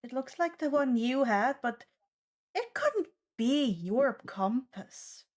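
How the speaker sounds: normal talking voice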